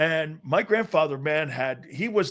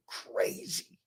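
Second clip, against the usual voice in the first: whispered echo; 2 semitones higher, 12 dB quieter than usual. Real, not acted. Echoes word for effect.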